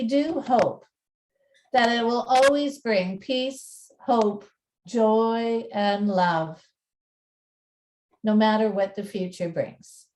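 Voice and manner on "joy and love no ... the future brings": ordinary talk, nothing marked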